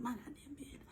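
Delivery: furious hushed whisper; angry whisper. Retold or imitated. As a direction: spit it out under the breath. Jealous husband muttering angrily.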